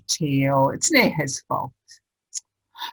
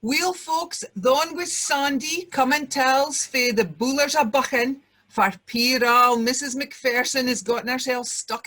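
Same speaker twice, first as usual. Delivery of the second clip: mock news anchor; 4 semitones higher than usual.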